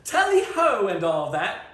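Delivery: high, hammed-up upper-class British toff; mock British accent. Dad greets the kids in hammed-up British, laughing. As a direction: play the dad's cheesy British greeting, laughing through it. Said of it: "your dad exclaims".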